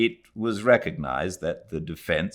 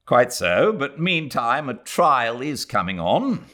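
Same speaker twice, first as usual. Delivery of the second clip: louder, heated retort; said hotly; 6 semitones higher, 5 dB louder than usual. A narrator's reading. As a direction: snap back in annoyance. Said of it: "said her son hotly".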